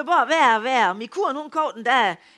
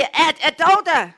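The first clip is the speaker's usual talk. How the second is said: shouting into the phone; 6 semitones higher, 7 dB louder than usual. Retold or imitated. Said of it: "Jeg råber nogen af så højt".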